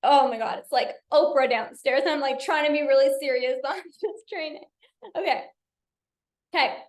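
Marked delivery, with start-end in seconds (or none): 2.1-4.6 talking through giggles; real laughter under the words; laughing. Real, not acted. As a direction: try to stay serious while laughing.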